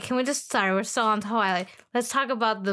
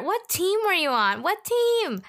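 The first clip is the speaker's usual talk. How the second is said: excited; 8 semitones higher than usual. Real, not acted. Excited question to friend.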